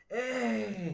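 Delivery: put-on high, drawn-out Mexican accent. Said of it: "With his Mexican accent".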